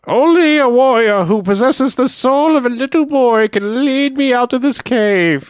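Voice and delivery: high, slow, otherworldly voice; mystical creature voice. Retold or imitated. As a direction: intone it like a strange cave creature. Quoting the creature's line.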